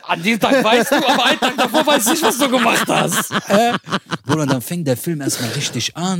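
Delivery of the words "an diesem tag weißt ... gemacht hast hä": high, laughing mockery of the judge's question; mock incredulous judge. Mocking the judge's question in a high laughing voice. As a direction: pitch it way up, laughing at the logic.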